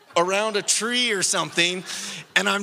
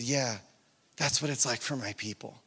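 low, hushed retold reply; quiet divine answer; 9 semitones lower, 8 dB quieter than usual. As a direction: speak softly and low, as God confiding.

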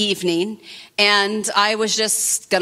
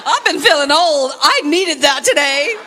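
laughing; 7 semitones higher, 3 dB louder than usual. Real, not acted. Laughing at herself after the encounter.

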